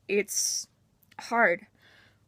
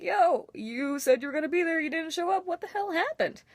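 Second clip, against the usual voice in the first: higher, imitating an annoyed friend; mock the friend who felt let down, pitched up; 9 semitones higher than usual. Friend complaining she missed the birthday, mock annoyed and higher. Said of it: "and you're like".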